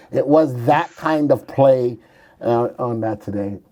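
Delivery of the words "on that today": ordinary delivery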